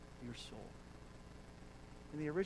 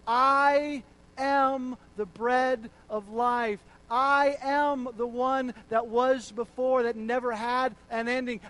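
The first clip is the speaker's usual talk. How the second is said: loud, slow, emphatic I am; preaching at full force; 4 semitones higher, 9 dB louder than usual. Acted. Preacher proclaiming Jesus' words loudly to the congregation. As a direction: hammer each I am.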